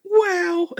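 high surprised wow; mock wow. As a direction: squeak out a startled wow. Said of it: "It was like".

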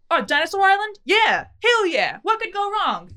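hammed-up eager tourist scoffing at danger; mock eager tourist. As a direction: play the clueless thrill-seeker. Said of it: "people who are like".

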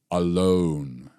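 one low, drawn-out word; weighty emphasis. Real, not acted. Preacher pointing listeners to the next word, low and deliberate. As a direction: set the word apart and let it land. Key word set apart after pause.

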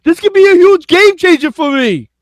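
loud, high-pitched Texan impression; excitable mock Texan yell; Texan accent. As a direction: holler it in a hammed-up Texan accent. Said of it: "that was my Texan accent".

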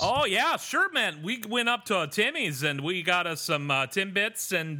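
high, hammed-up Canadian accent; mock Canadian small talk, pitched high. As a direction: put on a chirpy Canadian accent. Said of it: "in that accent".

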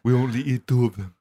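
lightly voiced deep-voiced seductress; a hint of a husky-man voice on a woman; Femme Brando. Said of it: "she had a voice much deeper than his own".

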